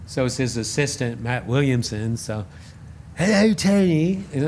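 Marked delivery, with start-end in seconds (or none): 3.1-4.2 lightly put-on English accent, high and slow; a polite English caller greeting Tony. Imitating an English caller's greeting, slow and high. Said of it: "English accent".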